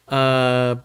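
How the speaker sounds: ordinary talk, nothing marked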